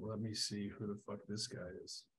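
very soft, quick and low-key; talking under your breath; muttered aside. Real, not acted. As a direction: mutter it to yourself while searching. GM looking something up to himself.

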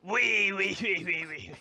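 put-on high cackling old hag; old hag laugh. Shown as a demonstration. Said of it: "Imagine a, an old hag saying that".

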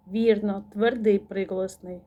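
usual speaking voice

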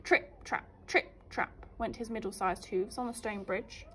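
ordinary delivery, nothing marked

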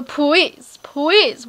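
put-on loud baby-talk PLEASE with W for L; cutesy begging pwease. Vlogger begging viewers to watch, cutesy "pwease" for PLEASE. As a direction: beg with PLEASE said as "pweeze". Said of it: "He's like, "Please, please".

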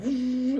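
high-pitched buzzing saw sound; imitated saw noise. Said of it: "they have a saw, and they're like".

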